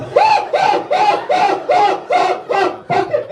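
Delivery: very high repeated ah yelps; shrill panicked shrieks. Retold or imitated. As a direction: shriek in disgust over and over. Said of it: "I weigh one twenty-five".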